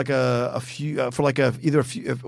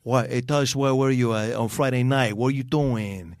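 overdone New York accent, lightly put on; New York cop accent. Imitating the New York cop from the show, mild New York accent. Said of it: "he talks like this all the time".

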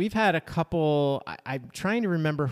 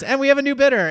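higher, louder mock auctioneer announcement; auctioneer call; 12 semitones higher, 6 dB louder, faster than usual. Auctioneer announcing the mom's accidental bid: mock auctioneer call. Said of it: "the auctioneer goes".

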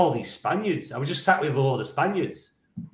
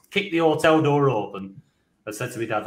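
ordinary delivery

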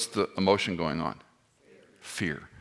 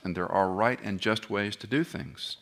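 usual speaking voice

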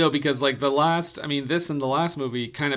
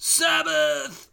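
shouted; 13 semitones higher than usual. Retold or imitated. Imitates clip's cry.

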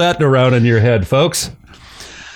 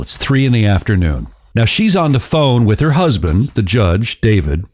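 ordinary delivery

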